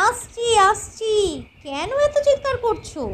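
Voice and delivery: high-pitched wife calling back; exasperated wife. Acted. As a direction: answer a noisy summons in a high voice. Wife answers her husband's shouting.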